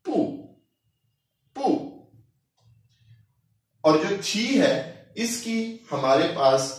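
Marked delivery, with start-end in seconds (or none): 0.0-1.8 पू said light and short in the neutral tone; पू light, short, unstressed. Shown as a demonstration. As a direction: tap पू quickly, with no tone of its own. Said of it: "तो हम इसको light and short pronounce करेंगे".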